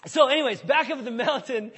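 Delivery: loud, laughing restart. Real, not acted. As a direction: laugh at himself and push on. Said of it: "so I get into it".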